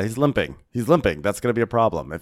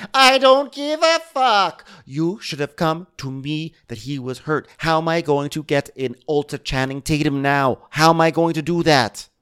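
mock outrage; 8 semitones higher, 6 dB louder than usual. Captain blowing up at stewardess.

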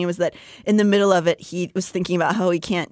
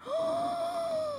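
shocked gasp; 12 dB quieter than usual. Acted. Shocked reaction to Nesta's question.

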